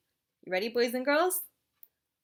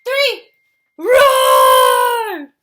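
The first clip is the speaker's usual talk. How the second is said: big scary roar; loud roar; 21 dB louder than usual. Real, not acted. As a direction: roar as loud as you can.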